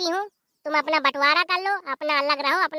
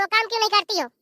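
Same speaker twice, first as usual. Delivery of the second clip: usual speaking voice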